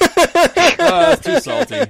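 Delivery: high, loud mocking complaint; mocking dad. Son mimics rude dad faulting mom's dinner, loud high mock whine. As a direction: squeal the complaint like a spoiled critic. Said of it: "he's like".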